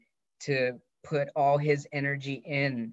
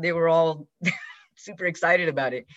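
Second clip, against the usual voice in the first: usual speaking voice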